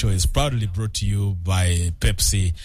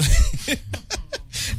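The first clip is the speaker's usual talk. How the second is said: high laugh. Real, not acted. Host bursts out laughing.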